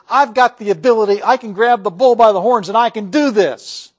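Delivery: hammed-up confident boast; mock bravado. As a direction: puff up like someone sure they can do it alone. Voicing a self-reliant boaster.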